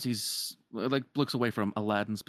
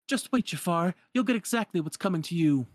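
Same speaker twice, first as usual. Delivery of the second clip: defiant princess; 8 semitones higher, 4 dB louder than usual. Retold or imitated. Princess defies villain in retelling.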